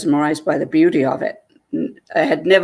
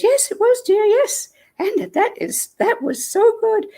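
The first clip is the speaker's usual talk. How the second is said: very high-pitched delighted lady, imitated; put-on high voice, gushing happily; happy hostess. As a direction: mimic a thrilled woman way up high. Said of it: "she's very happy".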